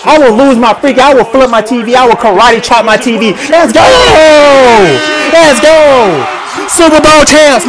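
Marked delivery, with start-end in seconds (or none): 3.5-7.7 high, elated victory cry; real jubilant fan shout; triumphant cheering. Real, not acted. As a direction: holler it out as a new champion. Celebrating championship win.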